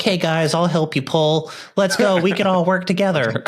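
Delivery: high-pitched mocking impression of a teammate; Dylan voice. Teasing a rider about helping everyone pull, mock impression. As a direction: pitch it way up, eager team-helper parody. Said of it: "I love [name]'s Dylan voice".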